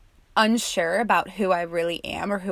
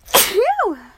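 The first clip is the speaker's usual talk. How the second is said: big exhale; 14 semitones higher, 8 dB louder than usual. Real, not acted. After sneeze, exhale.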